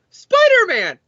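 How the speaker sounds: high, chirpy eager reply; squeaky high-pitched Spider-Man!; eager Dan answer. Acted. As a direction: pipe up like a kid begging for his favourite toy. Dan Slott voiced answering editor.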